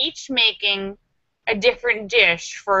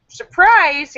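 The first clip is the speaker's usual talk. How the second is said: higher, louder gleeful exclaim; excited surprise; 7 semitones higher, 6 dB louder than usual. Real, not acted. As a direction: announce the surprise with glee. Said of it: "exclaims surprise".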